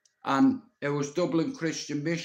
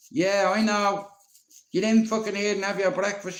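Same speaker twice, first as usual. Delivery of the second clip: higher, louder, fast granny telling off; granny scolding; 6 semitones higher, 4 dB louder than usual. Retold or imitated. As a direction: rush him off like a cross granny. Granny scolds, sends to breakfast.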